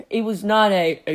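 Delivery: ordinary delivery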